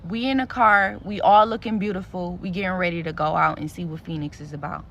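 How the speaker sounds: ordinary delivery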